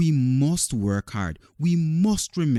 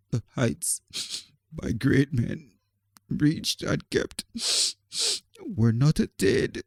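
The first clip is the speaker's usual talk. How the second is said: put-on crying boy; 4 semitones higher than usual. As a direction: hold back tears between phrases. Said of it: "and he's crying".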